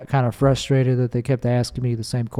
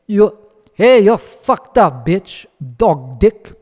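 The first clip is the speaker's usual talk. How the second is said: mock angry foreigner; 5 semitones higher, 3 dB louder, slower than usual. Reading angry user's insults.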